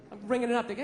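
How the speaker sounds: ordinary talk, nothing marked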